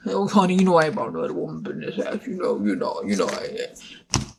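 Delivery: fast, low mumbled denial; Papa Bear. Acted. Papa Bear denies another woman to his wife, low hurried mumble. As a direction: rush it out, sinking into a low mumble.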